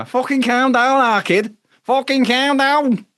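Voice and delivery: hammed-up impression of a character, drawn out; calm down character. Host doing a character with the hair, high and slow. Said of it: "I've got the fucking hair as well".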